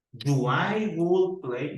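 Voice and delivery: deliberately slow mistake; slow wrong example. Shown as a demonstration. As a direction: drag out the wrong form.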